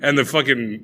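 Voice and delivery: ordinary talk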